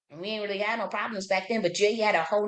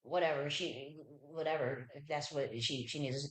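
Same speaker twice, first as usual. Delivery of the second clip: dismissive shrug; 4 semitones lower, 11 dB quieter than usual. Retold or imitated. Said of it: "some that was like".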